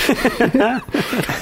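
short high burst of laughter. Real, not acted. GM laughs at joke.

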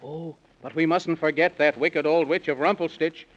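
ordinary delivery

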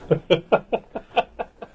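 a short, real chuckle; laughing. Real, not acted. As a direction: laugh at your own joke. Laughs at own joke.

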